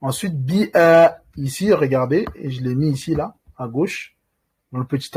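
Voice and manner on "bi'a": bi'a très lent, le a tenu deux temps; lengthened a. Shown as a demonstration. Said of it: "deux temps". (bi'a, le a prolongé lentement)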